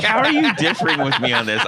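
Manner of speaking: high, loud, amused disbelief; laughing protest. Real, not acted. Host laughs in mock protest.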